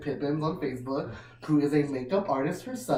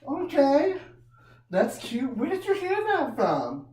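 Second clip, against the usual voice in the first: put-on high sweet kid-talk; 10 semitones higher than usual. Talking to a child.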